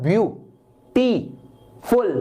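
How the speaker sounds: "ब्यू, टी, फुल" as three separate beats with pauses; syllable by syllable. Shown as a demonstration. Teacher counting syllables for students, "ब्यू... टी... फुल" said piece by piece. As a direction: break "ब्यू-टी-फुल" into three distinct sounds. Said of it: "आपको बोलना पड़ता है".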